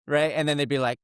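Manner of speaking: ordinary delivery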